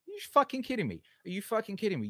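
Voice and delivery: high, incredulous outburst; are you kidding me, furious; outraged disbelief. Real, not acted. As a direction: let the outrage spike. Outraged rant at online critics.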